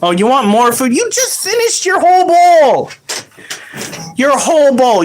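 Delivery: high mock parent scolding; fake calm angry parent.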